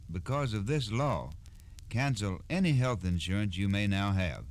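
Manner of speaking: ordinary delivery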